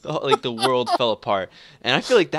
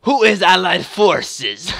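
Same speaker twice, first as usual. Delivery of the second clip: loud, high, drawn-out mock Russian; bad Russian accent; 8 semitones higher, 7 dB louder, much slower than usual. Russian player hunting Allied forces, bad slow Russian accent. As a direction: demand it in a hammy Russian accent. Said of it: "very bad attempt at a Russian accent".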